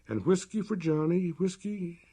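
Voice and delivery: high whining beg, rising in pitch; a simple man pleading for a drink; Johnny Bear's plea. A narrator's reading. Johnny Bear begs whiskey again.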